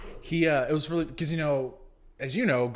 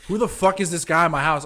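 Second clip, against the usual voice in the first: hammed-up angry yell, louder and higher; mock drunk yell; 6 semitones higher, 10 dB louder than usual. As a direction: bark it like a drunk host. Drunk Tad yelling at him.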